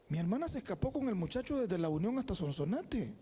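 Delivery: usual speaking voice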